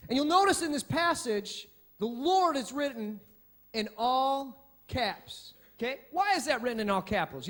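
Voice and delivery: ordinary delivery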